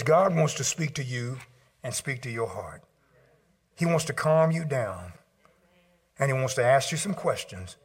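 ordinary talk, nothing marked

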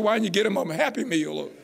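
normal talking voice

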